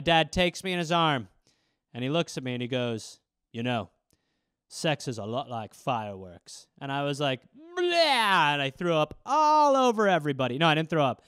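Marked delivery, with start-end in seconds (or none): 3.5-6.7 low, soft, earnest father; tender dad. Retold or imitated. Dad holding his son at the fireworks, low and soft. 7.5-8.5 higher, loud fake gag; mock retching. Boy gagging at dad's words, mock retch.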